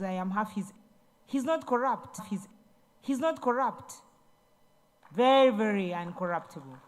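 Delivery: normal talking voice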